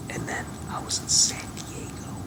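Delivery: very soft, near-whispered, breathy; hushed, airy wonder; hushed reminiscence. Real, not acted. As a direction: drop almost to a whisper, savoring the memory. Reliving his happiest memory.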